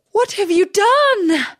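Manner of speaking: high, loud horrified princess; shocked cry. Acted. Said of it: "cried the princess".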